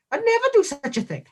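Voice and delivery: high-pitched, affronted protest in mock outrage; mock indignation. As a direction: protest with exaggerated, theatrical offence. Repeated mock denial, higher and louder.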